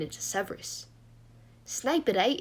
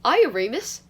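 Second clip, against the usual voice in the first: bright, louder, higher hello; cheery greeting; 5 semitones higher, 8 dB louder than usual. A narrator's reading. Christopher popping up to greet Remus, cheery. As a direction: pipe up with a cheerful hail.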